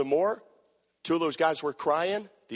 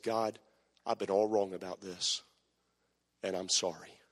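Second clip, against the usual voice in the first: low, soft confession to God; hushed retold apology; contrite prayer; 12 semitones lower, 9 dB quieter than usual. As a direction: pray quietly and humbly, pitched well down.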